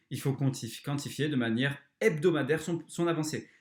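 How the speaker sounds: usual speaking voice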